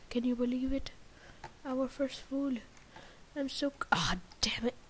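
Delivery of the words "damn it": normal talking voice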